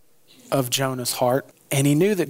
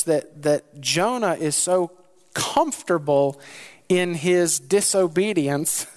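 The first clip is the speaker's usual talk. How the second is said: ordinary talk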